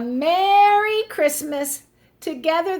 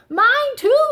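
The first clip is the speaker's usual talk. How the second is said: excited; 12 semitones higher, 6 dB louder than usual. Acted. Bear eagerly agrees.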